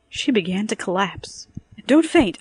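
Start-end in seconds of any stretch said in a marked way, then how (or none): 1.8-2.4 high, loud sharp order; sharp snap. A narrator's reading. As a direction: snap at her to pull herself together. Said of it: "I snapped".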